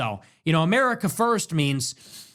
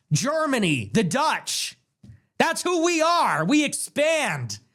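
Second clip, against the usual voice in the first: ordinary talk, nothing marked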